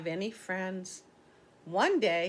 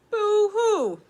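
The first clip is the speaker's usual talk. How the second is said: high, loud boo-hoo wail, much higher and louder; mock crying; 13 semitones higher, 10 dB louder than usual. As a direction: wail it like a sad little beaver. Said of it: "he said".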